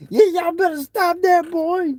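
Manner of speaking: high mock mama voice; imitating laughing mama. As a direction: tease them like an amused mother. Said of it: "She was laughing, though".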